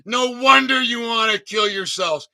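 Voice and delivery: ordinary talk, nothing marked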